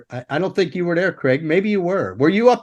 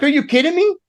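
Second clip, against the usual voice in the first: high, fed-up mock disbelief; incredulous; 12 semitones higher, faster than usual. Overloaded roadie venting about hats, high incredulous whine. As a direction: throw it up high like you can't believe it. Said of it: "I'm like".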